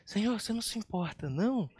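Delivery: soft, fast, timid asking; the polite version nobody really used; meek mock question. Shown as a demonstration. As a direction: ask it sweetly, almost apologizing. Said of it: "Eu não acho que ela chegou pra Jesus e falou assim".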